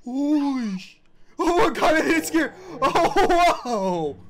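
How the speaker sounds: loud, high startled outburst; real fright. Real, not acted. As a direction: cry out shocked by a jump scare. Reacting to jump scare.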